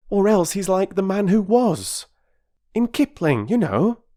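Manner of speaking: put-on keen boy showing off his reading; young Peter, eager.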